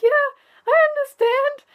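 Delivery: fake cheerful. Pretending to understand someone explaining, fake chirpy voice. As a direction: squeak out a bright fake yes. Faking understanding when worried.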